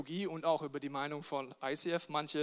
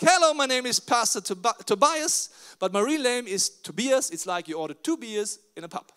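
loud, bright stage introduction in English; loud stage joke; 4 semitones higher, 9 dB louder than usual. Retold or imitated. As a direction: greet the whole conference from the stage. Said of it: "ich geh einfach auf die Bühne und sag". (laute Bühnenansage auf Englisch)

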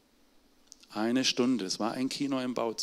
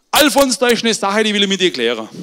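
viel höher und lauter, rufend ins Telefon; alter Vater am Telefon; 8 semitones higher, 13 dB louder than usual. Retold or imitated. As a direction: ein alter Mann, der laut in den Hörer ruft. Alter Vater ruft Sohn an. (alter Vater ruft laut seinen Sohn Alfons an)

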